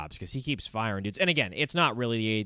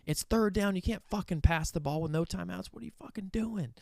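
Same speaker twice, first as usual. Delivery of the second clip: Brian Kelly yelling; 7 semitones higher than usual. Retold or imitated. Head coach chewing out his coordinator on third down, mock yelling. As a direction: imitate an exasperated coach in a strained high pitch. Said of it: "Brian Kelly yelling at Joe Sloan".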